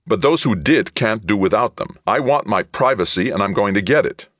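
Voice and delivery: loud, high insistent demand; indignant. A narrator's reading. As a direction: insist on it angrily. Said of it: "he retorted".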